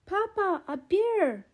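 high, loud startled call; excited child's call. Acted. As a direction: cry out to Papa at the sight. Willa spots a bear.

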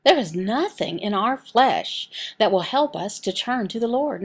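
ordinary talk

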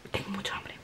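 hushed, breathy whisper of hunger; under the breath, almost no voice. Real, not acted. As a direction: whisper it like a secret craving. Craving food while studying.

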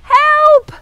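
high, loud storybook cry; cry for help. A narrator's reading. Mouse stuck in the hole, crying out. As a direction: call out high and loud for rescue. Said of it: "he cried".

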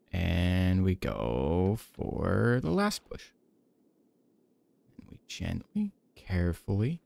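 slow, hushed play-by-play, every word eased out. Acted. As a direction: lull the viewer while placing the hammer gently. ASMR gameplay narration.

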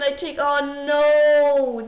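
slow then loud oh no; dismayed realization. Real, not acted. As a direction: catch the mistake as you speak. Realizing the blunder mid-line.

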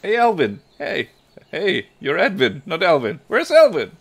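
high, loud call to a friend; calling out in character. Acted.

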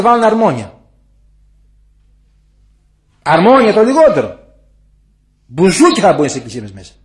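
preaching at full force against organs in church; fiery preaching. Acted. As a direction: thunder it from the pulpit as a warning.

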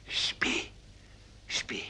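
whispered lullaby. Acted. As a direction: hush him to sleep in a whisper. Bear lulls hare.

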